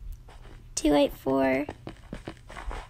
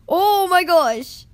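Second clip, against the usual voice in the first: loud, high, drawn-out outburst; astonished exclamation; 5 semitones higher, 8 dB louder, much slower than usual. Real, not acted. Gamer reacting to the game, astonished exclamation. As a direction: stretch it out in amazement.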